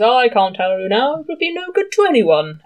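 lightly hammed-up coy Doctor; teasing secrecy. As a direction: keep the secret with a coy lilt. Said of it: "He goes".

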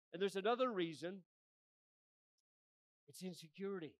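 loud, ringing sermon call, pitched high; preaching at full force. Real, not acted. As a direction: drive it home to the pews like a revival preacher. Preaches to congregation.

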